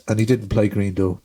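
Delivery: ordinary talk, nothing marked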